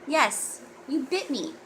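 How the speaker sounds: usual speaking voice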